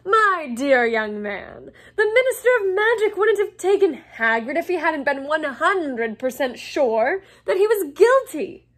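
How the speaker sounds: loud, high, pompous lecturing; condescending, theatrical talking down; patronising explanation. A narrator's reading. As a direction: explain it as if one and one makes two. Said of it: "in the tone of someone explaining that one and one makes two".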